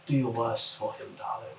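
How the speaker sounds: soft whisper into the ear, sweet and menacing; whispering figure. Acted. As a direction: lean close and whisper it like a sinister seducer. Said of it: "as a voice whispers in your ear".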